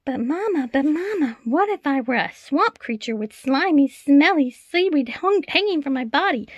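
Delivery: put-on high, loud little child begging Mama; a child tugging at Mama's sleeve; small child asking Mama, high and eager; pleading child. A narrator's reading. Child's voice in read-aloud story.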